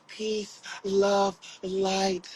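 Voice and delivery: mock-serene, words set apart; affected spiritual voice. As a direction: put on a fake guru voice, mocking it. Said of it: "and changing your voice".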